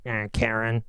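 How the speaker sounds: a hint of eye-rolling scorn; lightly mocking Karen jab; mock dismissive. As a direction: brush her off. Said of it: "people would be like".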